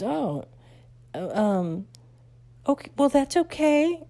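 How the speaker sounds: high, flustered consoling; awkward teacher. Retold or imitated. Teacher awkwardly consoling her about Louisiana, high and flustered. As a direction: backpedal awkwardly.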